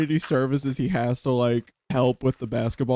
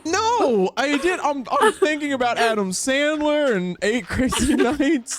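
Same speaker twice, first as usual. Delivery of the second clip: high voice, laughing through the words; slowed, laughing high reply; flustered laughter; 10 semitones higher, slower than usual. Real, not acted. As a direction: giggle out an excuse. Flustered after the scare.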